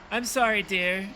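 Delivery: put-on high-pitched, polite old lady; apologetic old woman. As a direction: apologise as an elderly hostess, pitched well up.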